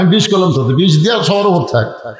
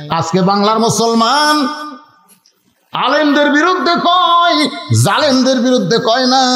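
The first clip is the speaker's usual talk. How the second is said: full-force preaching; 7 semitones higher than usual. Real, not acted. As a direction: lament it loftily, stretching each phrase end. Preacher rebukes the audience. (উঁচু সুরে টেনে ওয়াজ)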